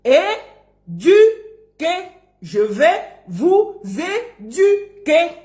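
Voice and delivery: slow, spaced "é-du-quer" for "éduquer"; each syllable of "éduquer" stands alone; syllable dictation. Shown as a demonstration. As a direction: teach "éduquer" like a school dictation. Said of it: "il apprenne à dire ça".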